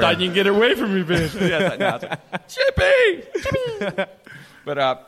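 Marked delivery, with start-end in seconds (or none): 0.0-1.3 menacing dog voice. Acted. Dog voiced as attacker, comic. 2.5-4.0 high shrill call for the dog. Retold or imitated. Calling out to the dog.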